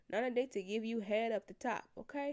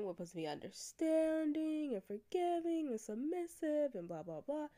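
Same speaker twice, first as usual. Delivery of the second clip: sarcastic listing; 6 semitones higher than usual. Real, not acted. Sarcastic list of expectations.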